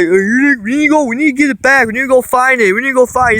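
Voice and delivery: high, fast panicked stepdad; freaking out. Retold or imitated. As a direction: rattle it off in a rising panic. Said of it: "He's freaking out and everything".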